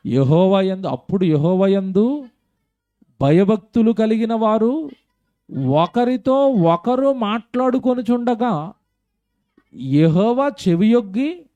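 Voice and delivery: measured preacher's reading of a verse; scripture reading. Acted. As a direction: read the verse slowly with weight, as from the pulpit. Reads the scripture verse aloud.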